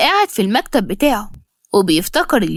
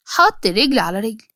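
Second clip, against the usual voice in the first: ordinary delivery, nothing marked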